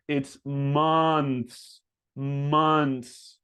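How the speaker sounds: months with an uh vowel; correct months. Shown as a demonstration. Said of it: "say it like this".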